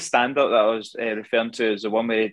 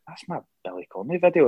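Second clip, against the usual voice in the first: higher, quicker quoted reaction; retold excited discovery; 6 semitones higher, faster than usual. Finding a Billy Connolly video on the street, retold excitement. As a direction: re-enact spotting your own video.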